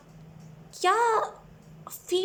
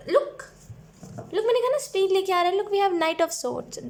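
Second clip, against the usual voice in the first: higher, louder, insistent "look"; excited vindication; 6 semitones higher, 4 dB louder than usual. Real, not acted. Tarot reader showing the card confirms her prediction, excited. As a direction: pounce on the proof, voice lifted.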